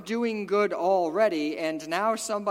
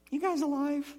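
ordinary talk, nothing marked

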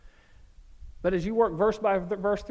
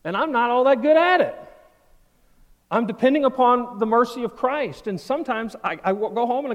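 preaching loud; 6 semitones higher, 9 dB louder than usual. Real, not acted. Preacher confessing dependence.

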